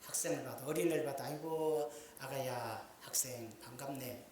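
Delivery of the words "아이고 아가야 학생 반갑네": soft, low, courteous welcome; kindly greeting. Acted. Preacher models greeting children and students, kindly. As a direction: greet a youngster gently, slowing at the end. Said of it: "이렇게 해야지".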